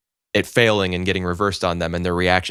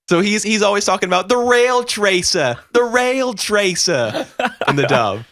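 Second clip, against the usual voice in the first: fake Brooklyn accent; 12 semitones higher than usual. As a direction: drag out the rail tracer in Brooklyn. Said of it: "he has a terrible, like, fake Brooklyn accent".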